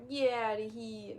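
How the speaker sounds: slow, loud New Jersey accent attempt. Shown as a demonstration. Streamer retrying a lost accent, loud New Jersey. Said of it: "How did I do that accent before?".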